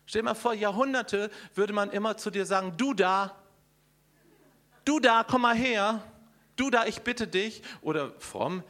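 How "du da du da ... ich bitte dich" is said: mock calling out. Mimics being called "du da.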